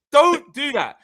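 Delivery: high, loud telling-off; forceful warning. Retold or imitated.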